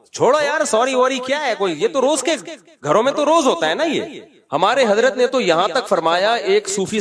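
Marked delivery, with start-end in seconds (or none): 0.1-4.2 mock brush-off, pitched up high; dismissive. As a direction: wave it away lightly. Mocking a dismissive husband. (طنزیہ انداز میں ٹالنا)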